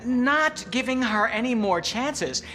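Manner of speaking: high, loud and slow, biting each word; angry. Acted. Boss refuses the employee another chance, angry and loud. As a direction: lay down the final word.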